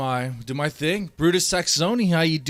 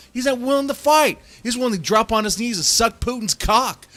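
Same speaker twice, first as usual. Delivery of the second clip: furious, loud and high rant; angry shout; 7 semitones higher, 5 dB louder than usual. Real, not acted. Streamer raging at the coach who won't fight, angry shout. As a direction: blast it out in real contempt.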